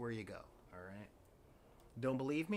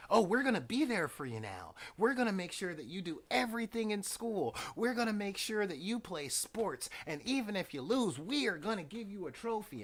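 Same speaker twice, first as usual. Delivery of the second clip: high, gushing parent voice; overeager parents; 8 semitones higher, 5 dB louder than usual. Acted. Parents suddenly promising to be there for their kids, overeager and high. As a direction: pile on promises high and insistent, with swooping pitch. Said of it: "the parents popped up again".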